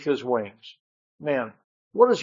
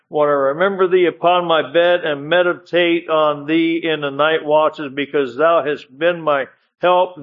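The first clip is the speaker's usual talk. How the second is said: raised, solemn preaching read of the Psalm; scripture reading; 4 dB louder than usual. Acted. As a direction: proclaim the verses to the congregation. Reads the Psalm aloud.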